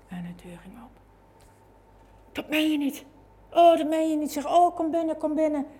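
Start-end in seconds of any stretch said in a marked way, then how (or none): 2.3-5.7 higher, delighted gasping welcome; overjoyed disbelief. Retold or imitated. Murderer's mother welcoming the visitor at the door, joyful high disbelief. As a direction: burst out in happy disbelief.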